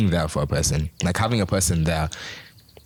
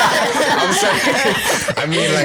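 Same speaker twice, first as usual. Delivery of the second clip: real laughter through high, loud words; laughing apology; 11 semitones higher, 5 dB louder, much slower than usual. Real, not acted. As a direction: apologise while cracking up, stretching the words. Cracking up over crude tangent.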